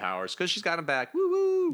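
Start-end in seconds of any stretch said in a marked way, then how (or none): 1.1-1.7 high sung woo woo effect. Pretend. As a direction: hoot it like a magic sparkle. Sound effect for mutant powers.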